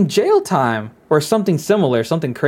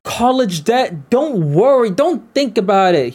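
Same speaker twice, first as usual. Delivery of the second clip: drawn-out, raised-pitch brush-off; mock reassurance; 5 semitones higher than usual. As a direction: imitate a politician waving worries away. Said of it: "he was like".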